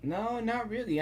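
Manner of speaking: ordinary delivery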